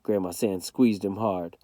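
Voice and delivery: usual speaking voice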